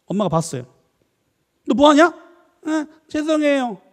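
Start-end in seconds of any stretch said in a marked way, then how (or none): 1.7-2.1 loud, high challenge; acted parental scolding. Mother catches child breaking something, loud scolding. 2.7-3.8 put-on high, slow child's sorry; apologetic child. Child apologises after being caught, high drawn-out plea.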